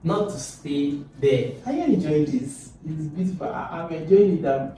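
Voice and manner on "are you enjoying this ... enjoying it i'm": ordinary talk, nothing marked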